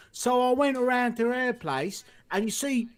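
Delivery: high-pitched, broad Cockney storyteller; Mickey Flanagan. Retold or imitated. Retelling a comedian's joke, in a broad Cockney accent. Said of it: "has the best Cockney accent".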